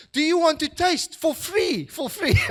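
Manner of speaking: put-on excited child. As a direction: squeal it like a delighted boy. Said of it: "he's so excited about the cool drink".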